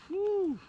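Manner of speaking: high breathy whew of relief; a tired hiker's high whew; exhausted whew. Real, not acted. Exhausted hiker on wet trail.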